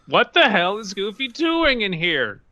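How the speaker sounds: high, incredulous exclamation; alarmed thought. A narrator's reading. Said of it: "Both Donald and Mickey were thinking".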